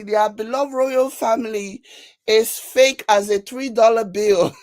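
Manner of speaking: ordinary delivery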